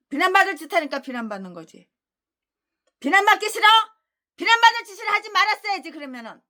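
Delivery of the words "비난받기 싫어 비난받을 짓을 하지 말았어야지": high, fast scolding; mock them, then slam the verdict; taunting rebuke. Real, not acted. Preacher taunting critics who hate blame.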